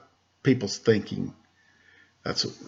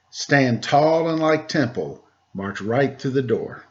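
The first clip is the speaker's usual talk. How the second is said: put-on reading to children. Teacher reading a Temple Grandin picture book to kids, lively storybook voice. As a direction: read the rhymes aloud to a class of kids.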